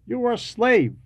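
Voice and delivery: ordinary talk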